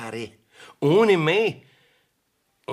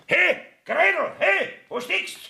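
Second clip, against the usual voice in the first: calling out. Acted. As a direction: call for Gretel from another room. Richter ruft die Magd herbei. (laut rufend nach Gretel)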